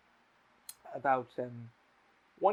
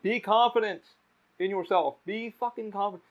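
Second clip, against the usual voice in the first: high, raised-voice call of encouragement; retold yell; 7 semitones higher, 4 dB louder than usual. Bystander urging a nervous guy at the mic, retold yell. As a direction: call it out like a heckle from the crowd. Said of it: "I yelled out, I'm like".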